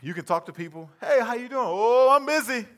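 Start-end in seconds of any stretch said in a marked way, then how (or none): 1.0-1.6 bright high friendly hello; cheery greeting. Acted. Asking a friend. 1.7-2.7 loud, high, curt brush-off; busy brush-off. Acted. Busy friend answering.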